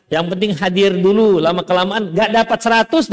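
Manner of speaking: ordinary delivery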